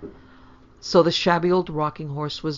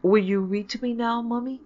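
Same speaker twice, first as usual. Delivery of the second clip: put-on eager child asking, pitch climbing; higher, hopeful little request; 7 semitones higher, 6 dB louder than usual. A narrator's reading. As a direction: ask keenly, lifting right up at the end. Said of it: "With unwonted eagerness".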